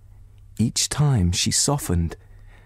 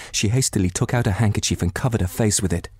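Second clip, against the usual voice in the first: usual speaking voice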